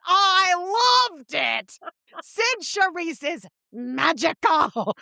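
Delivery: high, drawn-out rave about the show; gushing theatregoer impression. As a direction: stretch every word like a starstruck Long Island matron. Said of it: "a woman who talks like this".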